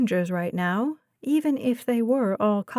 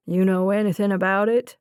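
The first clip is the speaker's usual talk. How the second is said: put-on southern accent; 2 semitones lower, 3 dB louder, faster than usual. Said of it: "The detective had a southern accent and a serious tone".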